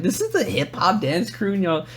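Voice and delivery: high-pitched mock disbelief; exaggerated baffled protest; incredulous complaint. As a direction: question them in a rising, high voice. Retells disbelief at bad dancers.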